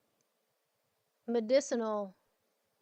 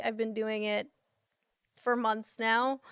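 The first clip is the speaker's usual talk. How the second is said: usual speaking voice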